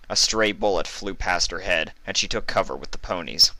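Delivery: plain reading voice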